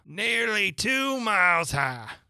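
high, slow, drawn-out pronouncement; royal accusation. A narrator's reading. Court insists Alice is over a mile high, drawn-out accusation. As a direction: stretch out the accusation imperiously.

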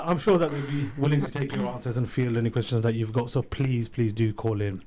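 ordinary talk, nothing marked

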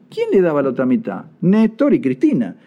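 usual speaking voice

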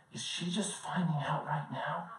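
hushed, secret whisper. Retold or imitated. Math teacher whispering to the student beside her, a hushed whisper. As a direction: whisper it so the mom won't hear. Teacher leans over during the conference.

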